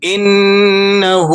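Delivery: إِنَّهُ with the final ه drawn out; stretched ha. Shown as a demonstration. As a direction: hold the ه of إِنَّهُ a second or two. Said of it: "ہا الٹا پیش ہو ہے تو ... کھینچ لینا ہے". (إِنَّهُ میں ہا کھینچ کر)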